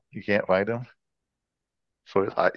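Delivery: ordinary delivery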